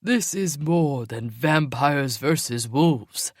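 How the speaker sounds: slow, firm reasoning; older wolf arguing for the bigger picture; arguing older male. Acted. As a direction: push back steadily. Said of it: "the older male argued".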